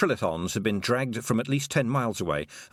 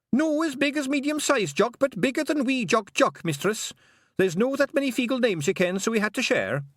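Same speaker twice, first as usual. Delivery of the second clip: high, loud Scots pixie voice, eager to please; a wee Scottish pixie chirping up to Tiffany; Feegle pixie voice; 3 dB louder than usual. Acted. Pixie telling Tiffany his long name, high and eager.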